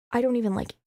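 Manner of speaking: ordinary talk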